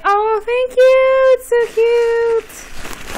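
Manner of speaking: high, slow, gushing squeal; drawn-out gush of delight. Real, not acted. Thanking the doll maker, a slow high gush.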